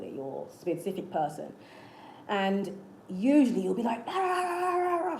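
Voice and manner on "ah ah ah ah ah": high-pitched wordless mock scream. Speaker imitating someone in an argument with their partner, mock screaming.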